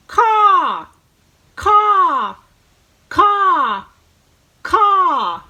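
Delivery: loud, high crow caw; cawing crow imitation. Shown as a demonstration. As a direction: call out like a crow. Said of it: "So let's try it".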